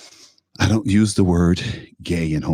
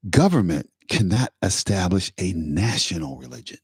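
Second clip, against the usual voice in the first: ordinary talk, nothing marked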